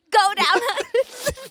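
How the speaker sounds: slow, high, hammed-up innuendo; slow and cheeky, like a bad Aussie; teasing Aussie voice. Teasing her co-host about Jason, slow mock Aussie.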